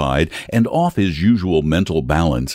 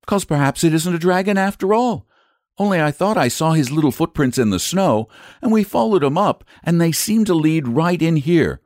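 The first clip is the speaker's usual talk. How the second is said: put-on higher-pitched little girl voice; earnest child explaining herself, pitched up; polite young Charlotte; 7 semitones higher than usual. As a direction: speak up high as a polite child.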